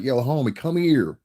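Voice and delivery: put-on LA-accented call, rising high; imitated LA accent. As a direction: put on a Cali street accent. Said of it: "when you hear them with their accent".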